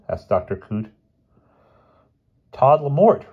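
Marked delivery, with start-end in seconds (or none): 2.5-3.2 tart reply. Acted. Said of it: "replied the professor tartly".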